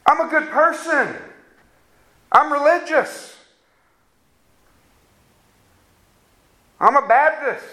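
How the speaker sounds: mocking a self-satisfied believer; smug self-righteous man. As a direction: boast like someone who thinks he's fine. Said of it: "You say".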